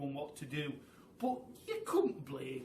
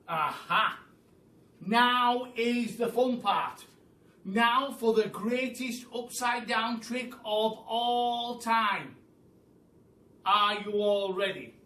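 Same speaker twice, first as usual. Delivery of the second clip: loud, slow, higher build-up; gleeful announcement; 5 semitones higher, 7 dB louder than usual. Acted. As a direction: tease the big trick. Mugglewump announcing the fun part.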